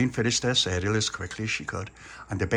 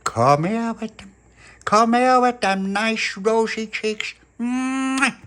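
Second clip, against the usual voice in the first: high, slow doting coo; cooing at a baby; 11 semitones higher, 5 dB louder, slower than usual. Acted. Little pappy cooing at baby Lucia, high and drawn out. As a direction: gush over the baby's cheeks. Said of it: "little puppy went".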